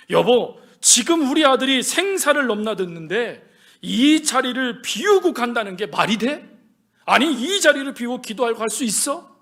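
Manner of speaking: loud, reproachful husband; acted heated objection; indignant protest. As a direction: challenge your wife in disbelief. Husband protests wife leaving sick son.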